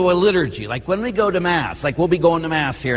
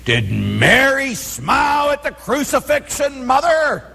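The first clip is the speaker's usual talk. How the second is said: high, rising retort to his mother; pitched way up, playful challenge; witty comeback; 10 semitones higher than usual. Retold or imitated. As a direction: toss back a clever mock-innocent question. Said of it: "priests always have great comebacks".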